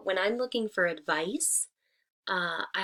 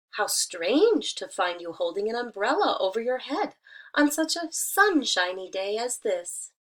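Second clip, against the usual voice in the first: lively, louder storybook reading; reading to children; 4 dB louder than usual. Acted. Teacher reading a fable to her students, lively storytelling. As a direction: read it out brightly for the class.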